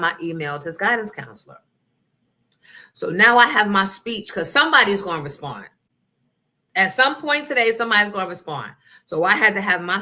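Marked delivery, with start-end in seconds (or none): none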